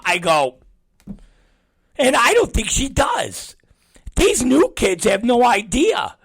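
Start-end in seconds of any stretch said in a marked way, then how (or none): 2.0-6.2 much higher, shouted scorn; mock the new kids loudly; retold scornful shout. Retold scoff at young staff.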